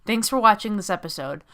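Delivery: loud, upbeat host sign-off; show outro. Real, not acted. Host closing the game showcase, upbeat announcer read. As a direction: wrap up the stream like a presenter.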